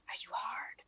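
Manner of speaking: unvoiced breathy whisper to co-host. Whispering a cheeky aside to Ronald mid-broadcast. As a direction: sneak it in under your breath.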